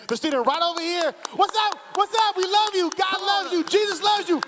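high, loud, fervent preaching cry; full-force preaching. Acted. Pastor rallies the church to stand and praise, loud and high. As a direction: whip the congregation up and get them on their feet.

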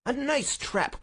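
high-pitched, snarling cry. A narrator's reading. Cornered villain in handcuffs, high snarling outrage. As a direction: spit it out high and bitter. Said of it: "cried the high, snarling voice".

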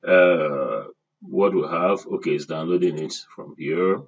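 ordinary talk

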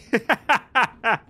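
high, loud peal of laughter; amused laugh. Real, not acted. Told his pronunciation is good for a Brummie, amused laugh. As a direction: burst out laughing.

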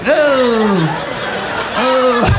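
high, hammed-up dying groans; shot-in-a-Western groan. Comic mimics buddy as if shot, mock dying groan. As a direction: groan like a gunshot victim in a Western. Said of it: "like my penis had shot him in an old Western".

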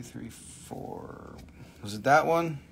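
normal talking voice

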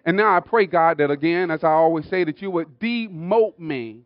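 fervent public prayer. Real, not acted. Pastor thanking God before the congregation, fervent prayer. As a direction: call out to God in rising phrases.

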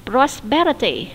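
"Prosperity" slowly, stressed on "per"; stress on second syllable. Shown as a demonstration. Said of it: "như vậy nó nhấn âm số hai".

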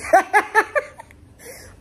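high loud laugh; burst of laughter. Real, not acted. Recalling the nonstop talker, laughing loud.

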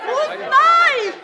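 loud furious command; angry outburst. Acted. Princess lashes out at the prince, loud furious command. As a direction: spit it at him.

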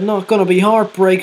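ordinary talk, nothing marked

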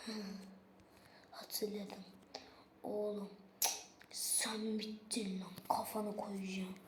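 whispered threat, slow and low; vengeful whisper. Acted. Threatening an enemy he just remembered, whispered menace.

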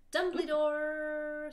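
slow, loud drawn-out Dumbledore; dramatic reveal. Acted. Narrating Dumbledore's entrance, slow and loud. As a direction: stretch the name for drama.

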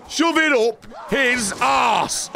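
high, drawn-out battle shout; shouted attack order. Real, not acted. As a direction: stretch every word of the command out slowly.

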